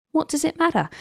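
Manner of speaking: lightly voiced mother, high and breezy; a hint of the unbothered mother; mother's easy shrug. Mother brushing off a child's fuss, light and breezy. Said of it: "She would often use this phrase".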